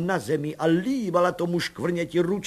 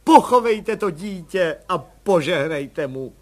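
slow, louder solemn request; 4 semitones higher, 8 dB louder, slower than usual. A narrator's reading. Mother asking the commissioner to bless the baby, solemn slow request. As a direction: ask it like a ritual blessing. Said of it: "řekla paní Landová slavnostně".

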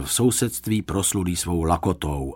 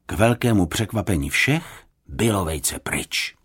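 usual speaking voice

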